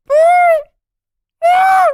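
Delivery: very high, loud mock squeal; fanboy squeal. S0 mocking Tom's excitement, loud high squeal. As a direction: shriek like a thrilled fan.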